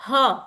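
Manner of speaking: "h" as a breath with short vowel, ha; visarg h sound. Shown as a demonstration. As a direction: say "h" as the visarg's puff of air. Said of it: "two dots behind a particular alphabet".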